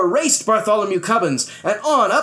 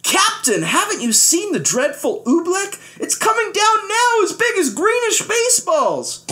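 high, urgent alarm; a high, pressing warning; 13 semitones higher than usual. Acted. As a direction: try to make the captain see the danger. Bartholomew warning the captain.

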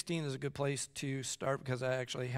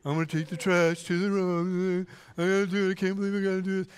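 put-on sulky kid; 3 semitones higher, 4 dB louder than usual. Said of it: "I can see it now".

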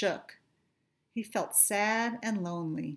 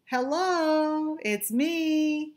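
high, louder call to friends; 8 semitones higher, 5 dB louder, much slower than usual. Acted. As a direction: call out brightly to find your friends. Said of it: "he called".

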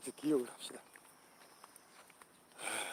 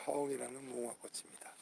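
whisper; 7 dB quieter than usual. Real, not acted. Unvoiced aside.

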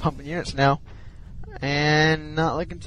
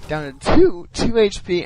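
excited; 5 semitones higher, 6 dB louder than usual. Real, not acted. Caster calls near-kill.

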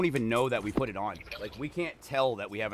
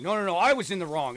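higher, louder insistent rebuttal; mock arguing back; 7 semitones higher, 9 dB louder than usual. Second Canadian arguing over who was wrong, higher and louder mock insistence. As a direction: cut in harder to claim the blame. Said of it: "then we just start arguing".

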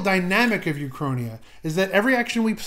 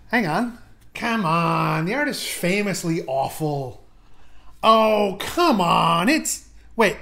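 ordinary delivery

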